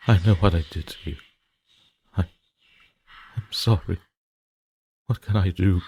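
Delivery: loud, frantic begging that builds to a cry; desperate pleading. Acted. Haunted man begging the screaming girl to stop, frantic loud pleading. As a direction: plead with her for your life, voice cracking.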